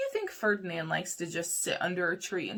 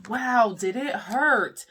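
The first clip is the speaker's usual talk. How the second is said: dramatic exclamation; 3 semitones higher, 8 dB louder, much slower than usual. Acted. Dramatic bee sting moment.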